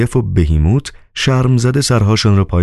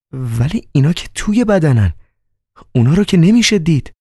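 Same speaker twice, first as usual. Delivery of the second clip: higher, amazed disbelief; astonished; 10 semitones higher than usual. Acted. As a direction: gasp it out in wonder. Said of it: "حیرت زده گفت". (با حیرت و صدای بلندتر)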